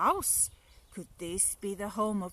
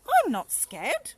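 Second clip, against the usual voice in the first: loud, higher, defiant claim; 8 semitones higher, 11 dB louder than usual. Acted. Gruffalo's child tells herself she's brave, loud and defiant. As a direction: insist on it loudly.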